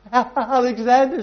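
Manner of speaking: high, slow, quavering answer; frightened reply. Acted. The sobbing soldier answering Alexander, high and slow with fear.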